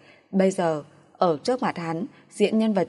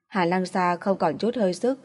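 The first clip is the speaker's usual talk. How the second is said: ordinary delivery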